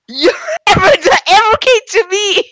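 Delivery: high, slow squealing; excited shriek. Real, not acted. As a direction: shriek with joy, drawn out. Screaming about viewers.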